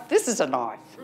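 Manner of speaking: low movie-hero boast, falling on knife; Crocodile Dundee. Acted. Capping an Australian film quote for the audience, low impression. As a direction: drop the voice for the punchline. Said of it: "Crocodile Dundee".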